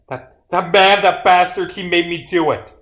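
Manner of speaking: cornered culprit lashing out in self-defence; anguished accusation. Acted. Exposed killer blaming the man who drove her, anguished outburst.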